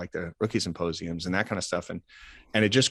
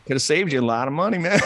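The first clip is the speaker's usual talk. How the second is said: louder, higher teasing retort; joking told-you-so; 8 semitones higher, 6 dB louder than usual. Retold or imitated. Coach to team president after the cut, joking told-you-so. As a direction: rib them for ignoring the advice.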